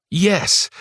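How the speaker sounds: high triumphant yelp; excited cheer. Acted. Tristan celebrates victory.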